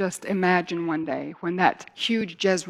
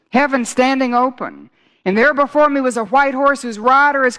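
loud, rising, higher preaching with ringing hallelujahs; full-force preaching; 4 semitones higher, 8 dB louder than usual. Acted. Preacher stirring up the congregation, full-force preaching. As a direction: build the sermon to a loud climax.

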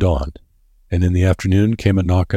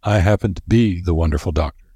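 plain reading voice

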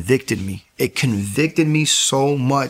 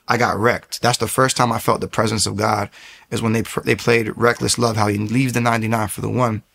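ordinary talk

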